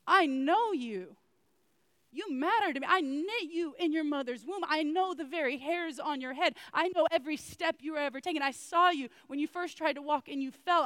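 God screaming. Acted. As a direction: shout God's love into the void. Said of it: "God is screaming into the void".

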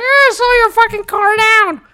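high, loud mock yell at passing drivers; hammed-up grumpy old man shouting at the street; bitter old man yelling. Grumbling at speeding cars outside, a loud mock old man yell. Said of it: "I'm just being an old bitter man".

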